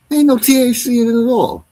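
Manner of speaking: can't believe there's no THC; outraged disbelief. Real, not acted. Streamer telling chat the vapes are fake, high and outraged.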